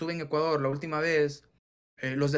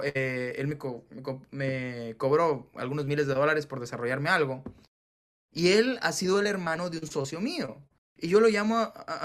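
ordinary talk, nothing marked

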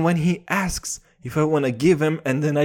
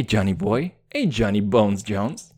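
ordinary talk, nothing marked

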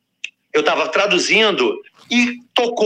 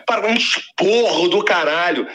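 normal talking voice

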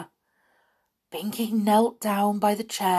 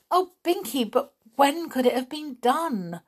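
high, protesting cry; distressed cry; 8 semitones higher than usual. A narrator's reading. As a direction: break in with a pleading protest. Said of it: "cried Molly".

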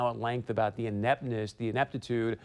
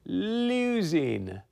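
long, stretched-out LOSING; drawn-out mock wail; 5 semitones higher than usual. Host jokes to viewers about the sound of Hillary losing, drawn-out and mocking.

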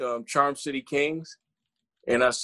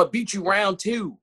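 higher, put-on Baltimore accent; mimicked battler with a Baltimore accent; imitated Baltimore accent; 8 semitones higher than usual. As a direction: do his heavy Baltimore accent. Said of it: "his Baltimore accent was really heavy".

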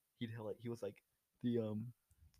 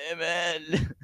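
bad impression voice; 4 semitones higher than usual. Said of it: "your start for every impression".

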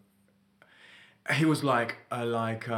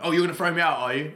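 snapping; 5 semitones higher, 5 dB louder, faster than usual. Retold or imitated. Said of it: "you get snapped on".

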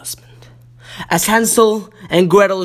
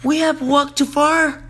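sighing Gretel; 9 semitones higher, 3 dB quieter than usual. A narrator's reading. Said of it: "sighed Gretel".

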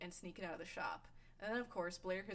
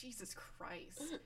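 slow, laughing disbelief; slow exasperated; 5 semitones higher, much slower than usual. Retold or imitated. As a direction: drag it out in appalled disbelief. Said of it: "and I was like".

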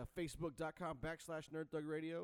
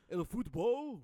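put-on Spanish accent; 7 semitones higher, 10 dB louder, slower than usual. Jokey Spanish commentator voice.

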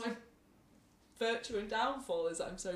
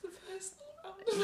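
laughing; 10 semitones higher than usual. Real, not acted. Friend laughing hard.

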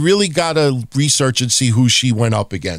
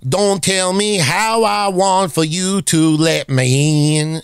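slow mock country twang on "Don't tell me how I want for you to let me in"; 4 semitones higher, slower than usual. Shown as a demonstration. Mocking country singers, slow country twang. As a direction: drawl it out with a Southern country twang. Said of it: "add a little bit of accent".

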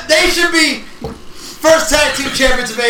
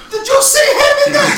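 excited; 12 semitones higher than usual. Real, not acted. Hyped about big wrestler.

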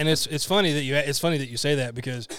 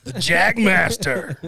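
grandiose; 4 semitones higher, 5 dB louder, much slower than usual. Pretend. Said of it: "I couldn't even say it with a straight face".

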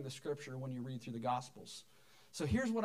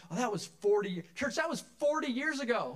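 higher, louder, playful "oh, that was forty" protest; mock indignant exclaim; 6 semitones higher, 6 dB louder than usual. Pastor voicing older members, mock outraged and louder. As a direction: play the over-thirty crowd, mock outraged. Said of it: "you're like".

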